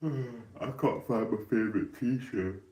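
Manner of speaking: whiny lament. Real, not acted. Sighing whiny complaint.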